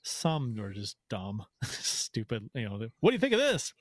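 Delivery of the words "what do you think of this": high, eager asking voice; squeaky hopeful fan; mock fan voice. Mocking fans asking opinion.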